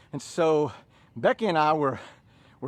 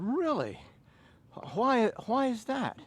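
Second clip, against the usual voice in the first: rising, higher incredulous ask; surprised question; 9 semitones higher, 4 dB quieter than usual. Retold or imitated. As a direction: ask back in disbelief. Acted quote of himself surprised.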